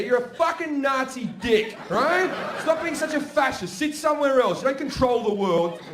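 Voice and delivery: mock rant. To parents who own a chair, shouted mock rant. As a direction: tear into them.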